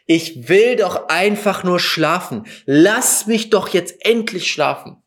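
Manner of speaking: acted-out exasperated plea, high and drawn out; desperate plea. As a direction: beg for relief, stretching the words. Acts out desperate plea at night. (verzweifeltes, gedehntes Flehen, nachgespielt)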